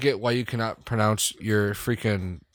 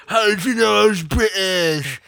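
higher, louder hammed-up British accent; mock British indignation; 8 semitones higher, 3 dB louder than usual. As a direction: play a silly Brit caught out. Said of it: "Like all the fucked up teeth".

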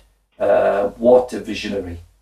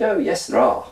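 posh gentleman; 8 semitones higher than usual. Retold or imitated. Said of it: "He said".

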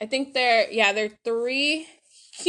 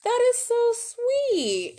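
higher, slow, sugary quote of a touched woman; touched friend; 11 semitones higher, slower than usual. Retold or imitated. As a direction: imitate her melting at the kind words. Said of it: "She was like".

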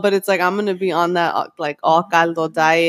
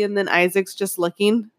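ordinary talk, nothing marked

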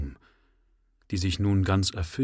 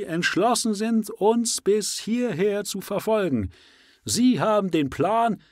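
high, loud zealous leader; rally the exiles with burning zeal; fiery preacher; 10 semitones higher, 4 dB louder than usual. Acted. Endicott's fiery speech.

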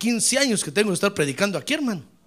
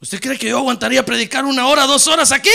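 louder preaching; 4 semitones higher, 7 dB louder, faster than usual. Acted. Preacher emphasizes long loud preaching.

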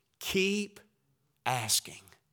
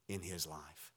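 normal talking voice

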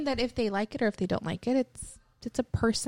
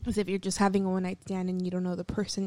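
normal talking voice

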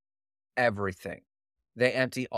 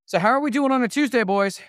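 high, loud, fast hype-up call; shouted show greeting; 12 semitones higher, 9 dB louder, faster than usual. Pretend. Host greeting the boys on a Tuesday, shouting. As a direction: holler it out to the whole crew.

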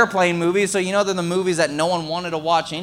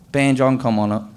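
ordinary talk, nothing marked

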